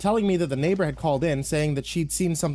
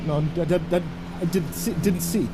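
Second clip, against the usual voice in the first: panicked, higher-pitched stammer; incoherent babbling; 5 semitones higher than usual. Acted. Traumatized driver answering the passenger, babbling incoherently. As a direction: stammer and repeat, stuck on the words. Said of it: "responded was with incoherent babbling".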